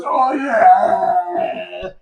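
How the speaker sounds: drawn-out delighted oh yeah; gleeful cheer. Retold or imitated. Knight grinning into the camera, drawn-out gleeful cheer. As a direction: crow it to the camera like a sitcom win.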